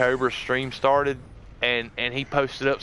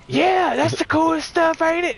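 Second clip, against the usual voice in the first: giddy excitement; 12 semitones higher than usual. Retold or imitated. His own excited quote.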